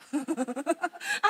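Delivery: real chuckling laugh; amused laugh. Real, not acted. Preacher reacts to her own crude punchline, laughing. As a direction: break into a run of chuckles.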